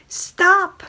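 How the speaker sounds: a sudden loud STOP, with Stop punched hard. Real, not acted. Reviewer ordering writers to quit it, a loud Stop. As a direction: bark Stop at the readers. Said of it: "romanticizing guys breaking into girls' houses".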